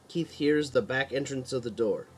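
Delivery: plain reading voice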